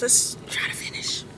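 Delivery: tired whisper of "Let's try to finish"; whispered to herself. Real, not acted. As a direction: breathe the words out voiceless. Repeat said in a whisper.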